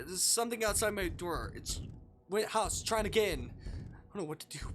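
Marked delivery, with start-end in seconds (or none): 0.1-3.5 higher, louder urgent report; panicked emergency call. Acted. Caller telling police something is outside, panicked. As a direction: blurt it out in alarm.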